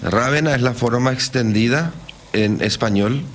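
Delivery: ordinary delivery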